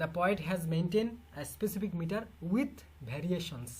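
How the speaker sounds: very slow, word by word, for students to write down; slow dictation. Shown as a demonstration. Teacher dictating a model exam sentence, slow and deliberate. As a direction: dictate each word slowly. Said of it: "এরকম এক্সপ্রেশনও আমাদেরকে লিখতে গিয়ে দিতে হয়".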